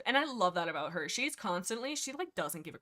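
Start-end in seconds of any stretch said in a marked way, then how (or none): none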